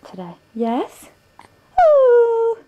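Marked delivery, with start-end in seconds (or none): 1.8-2.6 loud, very high "ooh"; high cooing squeal. Real, not acted. Mother answering her baby, high loud coo.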